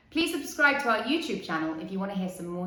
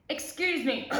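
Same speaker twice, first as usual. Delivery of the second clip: cricket voice; 7 semitones higher than usual. Acted. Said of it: "coughed a cricket".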